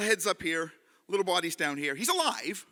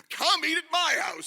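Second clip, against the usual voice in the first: high, loud acted invitation; hammed-up eager host; eager townsman; 9 semitones higher, 6 dB louder than usual. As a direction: call out over the crowd. Sam's invitation acted in story.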